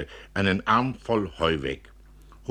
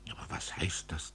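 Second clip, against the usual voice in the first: whisper; 3 semitones lower, 10 dB quieter than usual. A narrator's reading. Asking in hushed tone.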